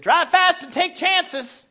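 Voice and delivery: high, slowed mock encouragement; mock reckless parent. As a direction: deliver it as a cheeky joke. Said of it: "Wouldn't it be much more funny".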